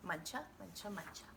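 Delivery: plain reading voice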